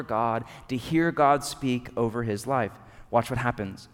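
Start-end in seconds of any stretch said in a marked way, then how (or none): none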